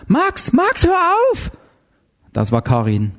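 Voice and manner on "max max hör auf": very high, urgent cry to stop; yelling at him. A narrator's reading. Karin pulling Max off the intruder, yelling. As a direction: yell high in alarm, without much volume. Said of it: "brüllte ihn an".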